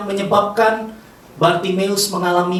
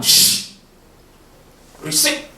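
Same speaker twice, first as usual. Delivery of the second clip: sharp shush to silence someone; scolding shush; shushing rebuke; slower than usual. Acted. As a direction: hush a noisy man. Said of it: "menegurnya supaya dia diam".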